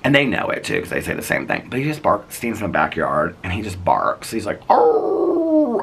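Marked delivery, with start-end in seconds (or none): 4.7-5.8 long, high hound howl; imitated dog howl. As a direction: howl loudly like the neighbor's beagle. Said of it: "I mean, howls".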